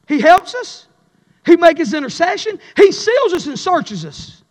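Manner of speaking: loud, high, chanting preacher; rising revival preacher cadence; fiery preaching. Acted. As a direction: preach it out with full force. Preaching at full force.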